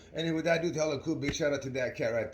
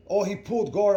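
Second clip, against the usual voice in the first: higher and louder, caught off guard; sudden surprise; 8 semitones higher, 9 dB louder than usual. Retold or imitated. Retelling the rematch twist to viewers, surprised and louder. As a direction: blurt it like it just happened.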